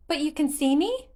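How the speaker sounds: high, wondering little voice; curious child. Acted. Korok amazed Link can see it, high wondering voice. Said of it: "it asked".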